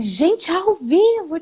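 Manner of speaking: slow, high, loud gasp of alarm; panicked disbelief. Retold or imitated. Hearing she starts live next week, panicked. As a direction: drag it out in retold panic. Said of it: "Aí eu entrei em pânico mesmo".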